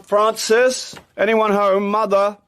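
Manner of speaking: loud, high call through the house. Acted. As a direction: shout to someone in another room.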